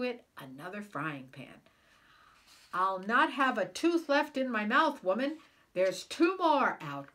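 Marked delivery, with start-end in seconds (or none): 2.7-7.1 loud, higher pained holler; hollering louder. Acted. Giant losing teeth to the bread, loud holler. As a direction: roar it out in toothless outrage. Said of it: "Cuchulainn hollered even louder".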